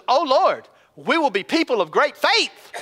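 over-eager congregation, put on; mock pious vow. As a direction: play the people grandly promising, hammed-up. Mock quote of the people vowing.

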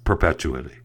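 "perpetuity" slipped into per-PETCH-oo-ity; mispronounced. Real, not acted. Podcast host tripping over a word, mispronounced. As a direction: stress PETCH in "perpetuity", with a ch sound. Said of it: "I can't even pronounce words anymore".

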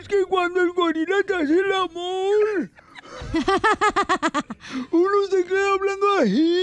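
high, strained voiceless cry; hoarse tiger. Acted. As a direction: call out like a tiger who lost his voice. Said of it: "bien afónico". (tigre afónico, voz ronca y aguda)

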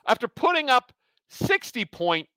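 ordinary delivery

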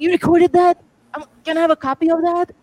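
high-pitched, eager disbelief; retold astonishment. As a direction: replay your amazed plea, pitch jumping up. Retold astonished reaction to recording.

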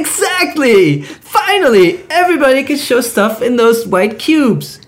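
high, eager, laughing pitch; excited Jeremy voice. Acted. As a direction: sell it with gleeful enthusiasm. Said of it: "I have to do the Jeremy voice".

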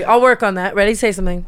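usual speaking voice

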